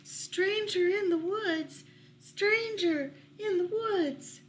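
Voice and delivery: high, slow bird cry of alarm; cawing warning. Acted. Blue jays warning the forest from high in the treetops, high cawing alarm. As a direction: sound the alarm like a jay calling down from the treetops. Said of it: "the blue jays cawed a warning".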